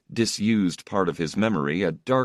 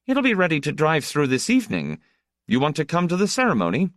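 put-on high, quick child's voice; eager young boy; 10 semitones higher, 3 dB louder, faster than usual. A narrator's reading. Boy inviting his older brother to the tunnel ceremony, eager. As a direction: rattle it out high like a busy little boy.

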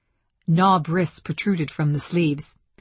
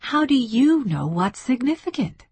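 higher-pitched, challenging question; demanding; 7 semitones higher than usual. A narrator's reading. Francine challenging Zakheim on significant languages, demanding. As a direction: demand it with rising indignation. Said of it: "she demanded".

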